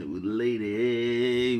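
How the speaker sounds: mock the nephew by dragging out old lady; drawn-out imitation. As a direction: stretch old lady out very slowly. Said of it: "Nephew says".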